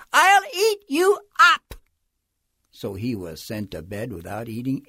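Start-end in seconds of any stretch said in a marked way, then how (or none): 0.1-1.7 put-on high, loud wild-boy threat; defiant child's shout. A narrator's reading. Max talking back to his mother, high defiant shout. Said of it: "and Max said".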